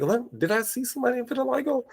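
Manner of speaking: high, soft amazed question; surprised double take. Retold or imitated. Teacher retelling her double take at the TV, a soft high question. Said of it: "And then I'm like".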